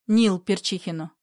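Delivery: ordinary delivery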